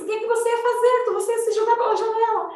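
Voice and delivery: high-pitched, urgent re-enacted questioning; retold alarm. Mother realises son would jump from window, high retold alarm. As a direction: challenge in alarm, voice held high.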